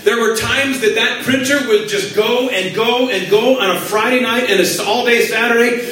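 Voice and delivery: ordinary talk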